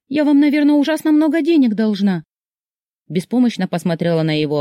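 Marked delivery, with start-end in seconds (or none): 0.1-2.2 high, loud, fretful voice; alarmed. A narrator's reading. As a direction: blurt it out, dreading the bill. Frightened about cost of damage. (встревоженно, высоким голосом)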